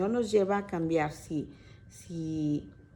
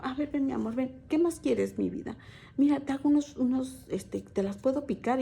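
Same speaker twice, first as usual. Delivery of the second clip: doting mother; 5 semitones higher than usual. Retold or imitated. Mother doting on teen son.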